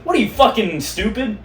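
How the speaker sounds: high, loud, mock-harsh jab; rude snap. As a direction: bark it at your friend jokingly. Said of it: "And I'll be like".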